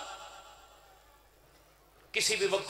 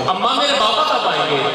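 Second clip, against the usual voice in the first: put-on child pleading; 3 semitones higher, 10 dB louder than usual. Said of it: "अम्मा का दामन हिला के कहती थी".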